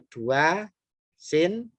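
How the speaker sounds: usual speaking voice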